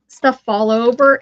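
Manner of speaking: normal talking voice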